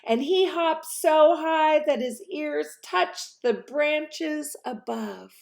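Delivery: high, bouncy storytelling; excited narration. A narrator's reading. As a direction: tell the tumble with delight.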